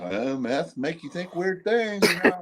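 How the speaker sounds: dismissive quote. Retold or imitated. Man recounting his own reaction to meth talk, dismissive. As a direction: stretch it out high, mocking the odd idea.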